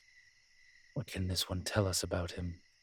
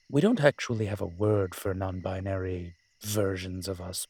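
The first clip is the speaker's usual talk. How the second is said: ordinary talk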